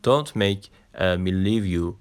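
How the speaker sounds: slow teaching demo. Shown as a demonstration. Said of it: "مجبورم نکن که ترکت کنم".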